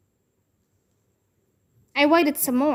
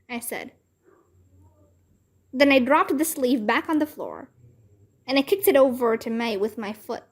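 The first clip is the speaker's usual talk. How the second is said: ordinary delivery, nothing marked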